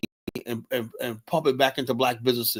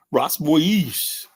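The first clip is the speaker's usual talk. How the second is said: mock French accent, a little raised; 3 semitones higher, 3 dB louder than usual. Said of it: "With that French accent".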